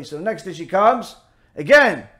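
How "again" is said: ordinary delivery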